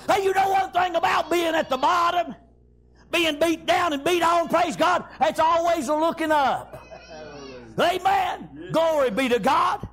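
fervent shouted sermon delivery; preaching at full force. Acted. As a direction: preach it hard, phrase after phrase.

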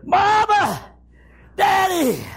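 high, spaced calls that fall away; hollering for parents. Acted. Child alone in the house, desperate hollering. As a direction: call out high, pausing between names.

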